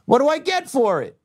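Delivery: high, aggravated outburst; exasperated rant. Real, not acted. Complaining to viewers about getting nothing, high exasperated rant. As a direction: let the grievance climb high.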